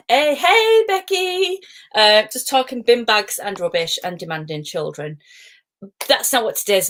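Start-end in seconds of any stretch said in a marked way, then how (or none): 0.1-1.6 high, bright call to a viewer; higher excited hello; excited greeting. Real, not acted. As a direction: call out hello to a friend on the stream.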